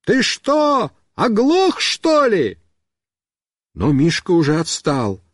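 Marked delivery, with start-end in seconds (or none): 0.0-2.6 exasperated high-pitched yell; high shouted question; shouting. A narrator's reading. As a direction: call out as if to someone deaf. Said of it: "Я кричу".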